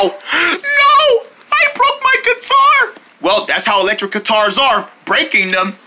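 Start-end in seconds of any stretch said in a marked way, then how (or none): 0.0-3.0 very high squealed wail; pretend anguish. Owner who broke the guitar, high anguished wail.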